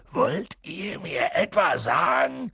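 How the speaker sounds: slow, menacing giant's growl; drawn-out angry growl. A narrator's reading. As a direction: growl it out with rising outrage. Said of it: "knurrte er sie an".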